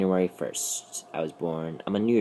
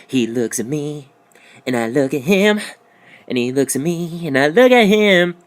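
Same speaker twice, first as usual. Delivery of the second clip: rising ever higher and louder; drive-thru video quote; 7 semitones higher, 7 dB louder than usual. Retold or imitated. Quoting the trapped in the drive-thru video, building from high to shrieking. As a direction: build the line toward a shriek. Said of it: "trapped in the drive-thru video".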